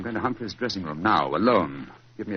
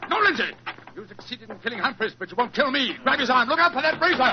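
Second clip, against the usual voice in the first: high, loud alarmed cries; urgent shout; 12 semitones higher, 3 dB louder than usual. Acted. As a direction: yell for help while grappling. Holmes fighting off the killer.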